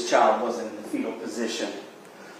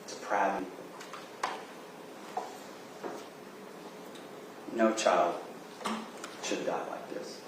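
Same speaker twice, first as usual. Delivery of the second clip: solemn, quiet closing argument; 7 dB quieter than usual. Real, not acted. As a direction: let the last words sink in quietly.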